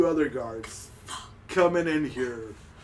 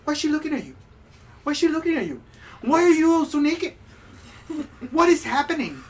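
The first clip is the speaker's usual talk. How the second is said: much higher, indignant demand; outraged wife; 10 semitones higher, 3 dB louder, much faster than usual. Acted. Wife scolding her husband, much higher and indignant. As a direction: demand answers, scandalised. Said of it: "and she's like".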